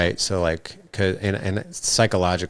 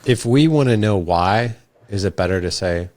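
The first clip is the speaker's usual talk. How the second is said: normal talking voice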